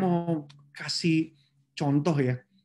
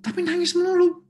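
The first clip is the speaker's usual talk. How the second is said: exasperated; 13 semitones higher, 6 dB louder than usual. Real, not acted. Complaining to the congregation about the crying employee, exasperated. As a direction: voice jumps high and loud in disbelief.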